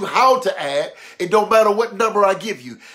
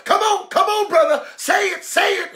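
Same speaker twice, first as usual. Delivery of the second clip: high, loud mock amen-corner cheering; hollering; 13 semitones higher, 5 dB louder than usual. Church member urges on a brother testifying, hollering high. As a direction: egg the testifier on excitedly. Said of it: "Somebody gonna holler".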